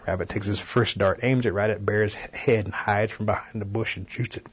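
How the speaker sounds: plain reading voice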